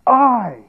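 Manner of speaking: "I" high and drawn out, falling; emphatic I. Retold or imitated. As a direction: hit "I" hard and let it ring alone. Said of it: "he's being emphatic".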